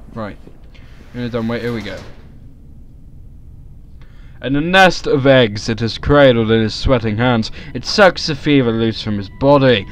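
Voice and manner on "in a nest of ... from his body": intense, theatrical story narration; dramatic reading. Acted. Reading the game's fevered dream passage, in dramatic narration. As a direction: read it out like a dark tale. Reads game text dramatically.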